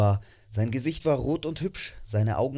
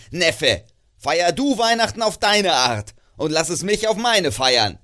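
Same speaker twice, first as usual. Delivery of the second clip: loud, bitter rant of a miser, raised pitch; grumpy old miser; 6 semitones higher, 9 dB louder than usual. Acted. Scrooge berates his cheerful nephew, loud and bitter. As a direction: spit out every word against Christmas.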